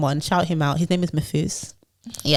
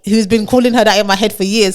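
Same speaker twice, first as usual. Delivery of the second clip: usual speaking voice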